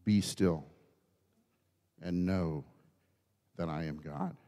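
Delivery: soft, low, unhurried repetition; hushed verse. Real, not acted. Preacher quietly repeats the verse to congregation, hushed. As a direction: let it sink down into stillness.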